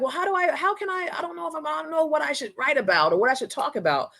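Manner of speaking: high, unsure voice of a confused student, well above her own; mock the lost, unsure asker. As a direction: students who don't know what to post, a high mock-confused voice. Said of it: "People are like".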